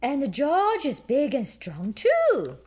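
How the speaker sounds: very high, slow, loud piglet boast; Peppa voice. A narrator's reading. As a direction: boast like a proud little pig girl. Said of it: "Peppa says".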